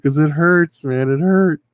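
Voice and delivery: a hammed-up hurt whine; whiny friend imitation. Friend explaining he ran from pain.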